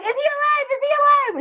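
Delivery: very high, anxious repeated question; be the little boy checking if his toy lives; imitating panicked boy. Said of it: "and he's like".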